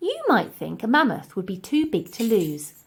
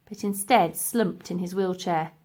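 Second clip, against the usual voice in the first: plain reading voice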